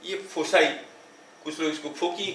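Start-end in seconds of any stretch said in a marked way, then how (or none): 1.9-2.3 "फोकी" with a hard k; "फोकी" ending in a kee sound; alternative pronunciation. Shown as a demonstration. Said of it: "ऐसे बोलते हैं".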